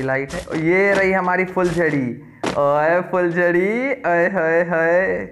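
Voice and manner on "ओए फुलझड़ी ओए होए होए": slow, drawn-out flirty call to a pet; playful drawn-out hey there; teasing call. Real, not acted. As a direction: stretch it out, cooing at the pet. Calling his pet in game.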